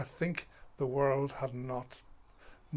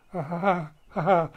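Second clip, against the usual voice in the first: loud, higher mock laughter; imitated titter; 4 semitones higher, 9 dB louder than usual. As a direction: act out a girl tittering in assembly. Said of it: "who tittered".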